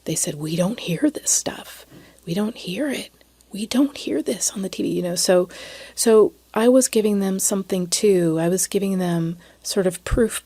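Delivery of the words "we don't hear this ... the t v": quoting women in a whisper; hushed whisper. Retold or imitated. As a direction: lean in and whisper it. Women confiding in hushed tones.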